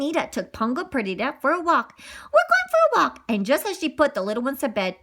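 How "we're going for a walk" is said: much higher sing-song; high bright character line; high chirpy voice. Acted. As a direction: announce the walk brightly.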